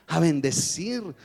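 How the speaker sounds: declaring faith. Retold or imitated. Doctor teaching patient to declare faith, slow and fervent. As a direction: proclaim healing with conviction.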